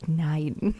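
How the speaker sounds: loud, hammed-up German accent; worst German accent. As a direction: put on a silly German accent, punched out. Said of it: "That was the worst German accent ever".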